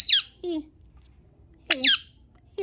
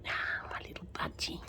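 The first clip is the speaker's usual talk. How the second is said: whispering; 18 dB quieter than usual. Real, not acted. Whispering to pet budgie.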